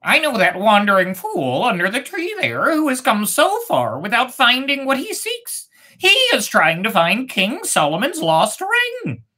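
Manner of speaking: high chirpy gossiping bird voice; first bird. Acted. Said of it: "The first bird said".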